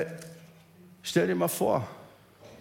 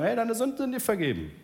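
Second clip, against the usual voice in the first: higher, breezy throwaway tone; easy offhand line; 7 semitones higher than usual. Shown as a demonstration. Preacher mocks how easy the words are, breezy. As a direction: toss it off as if it costs nothing. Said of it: "Es ist wirklich einfach, jemandem zu sagen".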